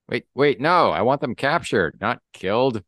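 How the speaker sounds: high, loud, hurried-in correction; urgent objection. Acted. Alarmed underling corrects the villain.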